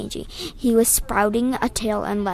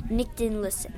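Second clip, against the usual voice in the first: plain reading voice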